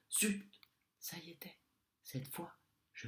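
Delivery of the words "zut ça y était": dismayed exclamation. Acted. Realizes his slip.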